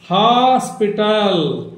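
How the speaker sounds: Hospital drawn out slowly; slow model pronunciation. Shown as a demonstration. Teacher models the whole word for practice, drawn out. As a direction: stretch hospital to close the spelling. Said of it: "ఇట్లా మీరు practice చేయాలి".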